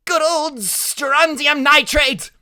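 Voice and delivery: high, loud, drawn-out cry; excited shout. A narrator's reading. Freak naming the firework chemicals, shouting high and slow. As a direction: call it out slowly at a high pitch. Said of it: "Freak keeps on shouting out the names of chemicals".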